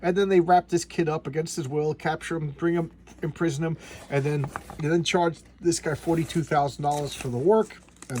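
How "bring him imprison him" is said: ordinary talk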